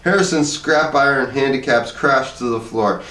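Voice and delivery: ordinary delivery, nothing marked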